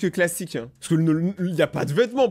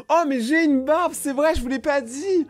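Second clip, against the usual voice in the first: mock surprise; 9 semitones higher than usual. Fake discovery of own beard.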